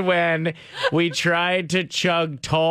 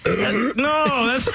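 laughing; 9 semitones higher, much slower than usual. Real, not acted. Laughing at walrus burp.

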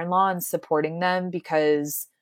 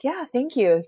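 self-congratulating; 7 semitones higher than usual. Retold or imitated. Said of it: "patting myself on the back".

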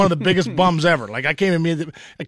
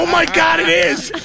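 shocked shout; 11 semitones higher than usual. Retold or imitated. Answering his wife about the jacket, shocked shout. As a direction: blurt it out in horror, laughing. Shocked recall of the cat pee.

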